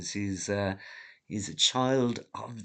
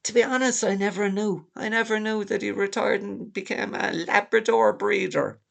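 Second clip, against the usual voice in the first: hammed-up old dad, much higher and a bit louder; mock his father's confusion in a high voice; Doomer Dad, mock voice; 10 semitones higher, 5 dB louder than usual. Son mimics dad confused about the movie ending, high mocking voice. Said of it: "And he's going".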